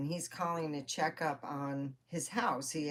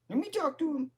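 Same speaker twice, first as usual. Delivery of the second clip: ordinary delivery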